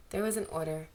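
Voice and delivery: usual speaking voice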